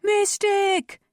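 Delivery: high, loud accusing yell; mob cry. A narrator's reading. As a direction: cry out as an angry crowd. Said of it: "dozens of voices cried".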